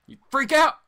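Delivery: loud, high burst of excitement; excited outburst. Real, not acted. Vlogger describing cheap-comic shopping frenzy, excited shout. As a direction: shout it like you can't help yourself.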